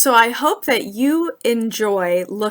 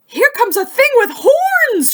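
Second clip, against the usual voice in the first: much higher, louder alarmed rabbit cry; shouting rabbit; 7 dB louder than usual. Acted. Rabbit warns everyone, high alarmed shout. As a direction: shout the warning like a scared rabbit. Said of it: "shouted a rabbit".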